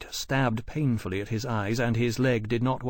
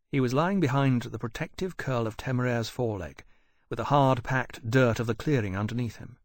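ordinary delivery, nothing marked

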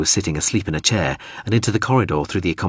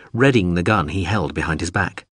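plain reading voice

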